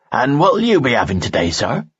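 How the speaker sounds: put-on bright, cheerful waitress with Baltrian accent. A narrator's reading. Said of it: "she asked cheerfully, with a thick Baltrian accent".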